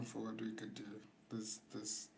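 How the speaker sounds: low, hushed, sly pitch of a suggestion; soft naughty voice, dropping away. Real, not acted. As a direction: float the idea softly in your naughty voice. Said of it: "Why are you putting on your naughty voice?".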